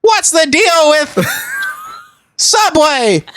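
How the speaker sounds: high, slow stand-up comic bit; Jerry Seinfeld. Acted. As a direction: deliver it like a Seinfeld observation. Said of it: "He sounds like Jerry Seinfeld".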